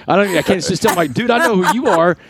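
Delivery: high, breathless fan gushing, pitch climbing; a starstruck fan who can't believe the call; freaking out. Retold or imitated. Fan on the phone to his hero, freaking out with excitement. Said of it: "like, freaking out".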